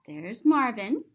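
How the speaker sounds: plain reading voice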